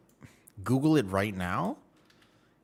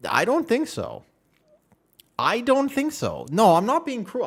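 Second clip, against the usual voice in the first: loud, high-pitched objection; disbelieving protest; 12 semitones higher, 6 dB louder than usual. Real, not acted. Streamer refusing to believe chat about lads, protesting in high disbelief. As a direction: protest it loudly, voice jumping way up. Disputes what chat told him.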